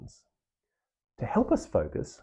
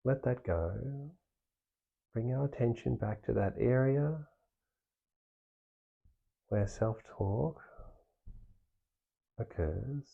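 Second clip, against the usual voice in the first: softer, unhurried meditation guide with long pauses; guided meditation; 4 dB quieter than usual. Acted. Meditation teacher guiding listeners through practice, soft and calm. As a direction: ease the listener inward, gently.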